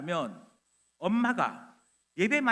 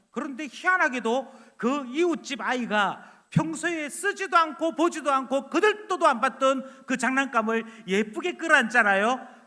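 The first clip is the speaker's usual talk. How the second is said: ordinary talk, nothing marked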